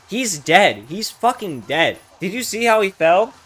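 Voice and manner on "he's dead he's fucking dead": ordinary delivery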